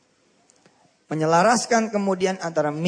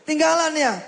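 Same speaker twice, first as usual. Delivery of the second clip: a playful, laughing tease pitched high; teasing question; 10 semitones higher than usual. Retold or imitated. Preacher voicing a friend teasing the left-behind boy, mock playful. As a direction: poke fun at the boy who was left behind.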